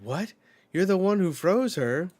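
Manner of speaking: high, loud disbelieving cry; shocked. Retold or imitated. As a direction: blurt it out in shock. Chopper shocked in retold scene.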